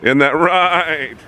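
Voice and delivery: slow, high, coaxing question; coaxing the goats. Real, not acted. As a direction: coo it to the goats, drawn out. Coos at the goats.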